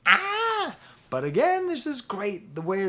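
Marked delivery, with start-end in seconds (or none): 0.1-0.8 sharp high yelped ah; mock ad-lib yell. Reviewer imitates a yelp from the track, high and loud. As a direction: bark out a sudden high ah. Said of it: "It's just like".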